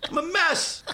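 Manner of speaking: venting, half laughing, close to yelling; worked-up yelling. Real, not acted. Telling his friend the face-petting story, frantic and high. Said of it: "Listen to my voice. I'm a mess".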